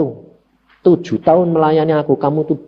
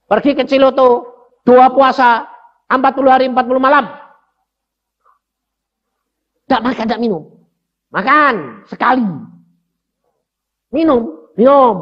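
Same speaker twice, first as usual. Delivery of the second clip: raised, commanding voice of God; God giving orders; 8 semitones higher, 4 dB louder than usual. Retold or imitated. God ordering the preacher to fast, raised and commanding. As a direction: announce the fast like a divine command. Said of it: "Tuhan bilang".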